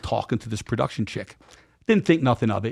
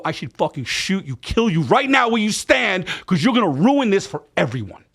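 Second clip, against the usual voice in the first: angry outburst; 7 semitones higher than usual. Retold or imitated. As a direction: tear into him, outraged. Threatening friend in anger.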